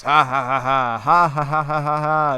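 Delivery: loud staccato mock villain laugh; hammed-up evil "ha, ha, ha" of a cartoon baddie. Two-Face cuts Robin's rope, laughing loud and hammed-up. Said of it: "That's what he always says".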